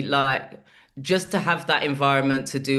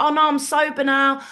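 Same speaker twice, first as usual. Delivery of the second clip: put-on high, loud, earnest drunk claim; drunk friend protesting; 9 semitones higher, 4 dB louder than usual. The drunk friend insisting to the comic he's sober, high-pitched. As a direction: plead you're sober.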